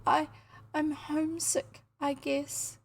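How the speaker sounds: put-on high, halting, tearful child; acted sobbing. A narrator's reading. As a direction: stammer it through mild mock sobs. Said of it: "sobbed Emily".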